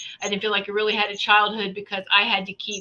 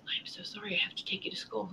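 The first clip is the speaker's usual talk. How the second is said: hushed apologetic whisper; bus driver whispering; 3 semitones lower, 19 dB quieter, faster than usual. Retold or imitated. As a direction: whisper it like breaking bad news gently.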